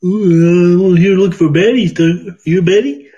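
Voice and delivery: loud put-on frog puppet voice; Kermit the Frog. Answering a request for Kermit, loud frog puppet voice. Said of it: "able to do Kermit the Frog".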